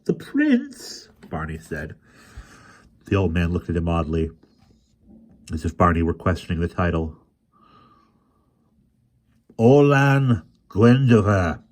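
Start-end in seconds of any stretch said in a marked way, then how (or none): 0.1-1.1 much higher, startled question; a boy caught off guard; surprised boy. A narrator's reading. Barney surprised by title. 9.6-11.6 slow, louder, proudly naming the prince; chilly pride. A narrator's reading. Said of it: "with chilly pride".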